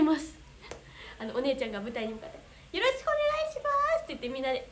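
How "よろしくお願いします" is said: imitated loud greeting. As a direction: imitate a chorus greeting the stage. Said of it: "大きい声で言ってます". (大きな声の挨拶を高い声で再現)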